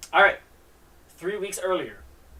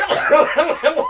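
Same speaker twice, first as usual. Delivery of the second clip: high loud burst of real laughter; 12 semitones higher, 12 dB louder than usual. Real, not acted. Reader laughs at his old comic art, bursting out loud.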